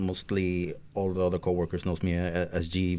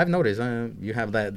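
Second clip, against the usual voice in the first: ordinary talk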